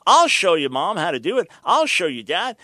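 put-on loud, smug teenager voice; mock cocky teen. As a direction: show off like a know-it-all kid. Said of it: "the fifteen-year-old says".

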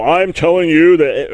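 loud, bossy order, acted out; mock commanding. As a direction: bark it like someone ordering people around. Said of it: "I don't mean that we command people".

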